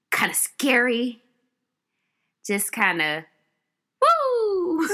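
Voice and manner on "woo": high, drawn-out woo; swooning high-pitched "woo"; admiring whoop. Real, not acted. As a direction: whoop over someone hot. Swooning over hot, dangerous Tybalt.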